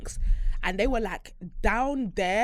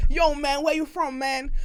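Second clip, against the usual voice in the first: higher, louder put-on American slang; mock American accent; 6 semitones higher, 5 dB louder than usual. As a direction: tease him with a hammed-up American accent. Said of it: "you don't have an American accent".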